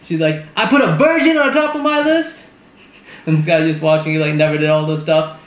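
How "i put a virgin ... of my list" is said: mock outrage. Retelling how Haley got pissed off, mock outrage. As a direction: play her pissed off and incredulous.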